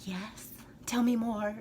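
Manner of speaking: slow, soft, captivated murmur; drawn-out, hushed mock swoon. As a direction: play entranced by a lovely language. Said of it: "I'm just like".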